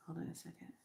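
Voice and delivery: whispered aside. Real, not acted. Pausing to viewers while sending energy, whispered. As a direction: breathe it under your voice.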